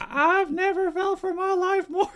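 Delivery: high-pitched put-on panic; mock fearful. Podcaster quoting man who got lunged at, high mock fright. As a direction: squeal it as a hammed-up scared victim.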